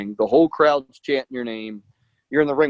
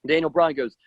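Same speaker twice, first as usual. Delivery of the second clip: ordinary talk, nothing marked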